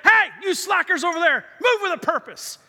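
loud, high mock army shout; imitated sergeant major. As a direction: holler at slacking soldiers like a sergeant. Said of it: "my sergeant major would see us".

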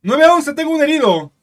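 high, loud panicked caller; mock emergency call. Joke setup, co-host plays a frantic emergency caller. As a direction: shout for help like a hammed-up emergency call. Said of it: "momento de chiste".

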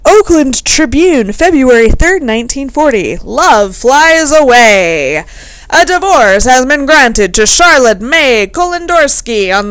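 put-on loud, declaiming old bulletin voice; mock newsreader. Host reads out an old divorce notice, loud mock newsreader. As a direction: belt it out like a breaking headline, stretching key phrases.

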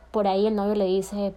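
normal talking voice

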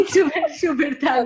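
high, loud laughing words. Real, not acted. Laughs while saying she's happy.

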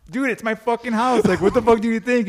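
loud, high mock indignation; exasperated retort. Host answering his buddy at the door, loud exasperation.

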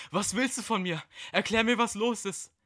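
hoch und laut, panisch fordernd; verzweifelte Frage. Acted. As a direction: fordere verängstigt eine Erklärung. (hohe, laute, panische Stimme)

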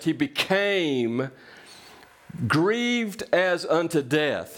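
slow emphasis. Real, not acted. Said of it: "slowed emphasis on Jesus' grief".

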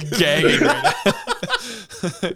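words squeezed out through laughter; laughing. Real, not acted. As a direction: say it while cracking up. Laughing at co-host choking.

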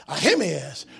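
ordinary talk

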